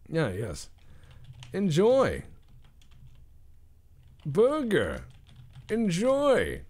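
high, loud mock server voice; hammed-up vendor voice. Burger chef serving a customer, high hammed-up voice. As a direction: shove the burger at them grandly.

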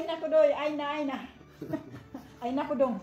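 ordinary talk, nothing marked

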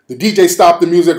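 ordinary talk

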